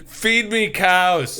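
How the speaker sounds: very slow, heavy giant demand. Pretend. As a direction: drag it out like a hungry giant. Playing a giant second grader.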